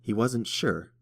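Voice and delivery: usual speaking voice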